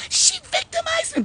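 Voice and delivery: very high, squealed mock complaint; mock victim. Karen accusing the speaker of victimizing her, mock-victim squeal. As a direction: wail it in a ridiculous high victim voice.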